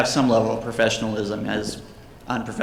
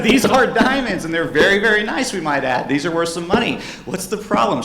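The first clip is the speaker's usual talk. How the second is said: acted high-pitched, baffled pleading; exasperated insistence; 7 semitones higher, 4 dB louder than usual. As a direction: press the point, voice pitched up in disbelief. Acted exasperated reassurance to customer.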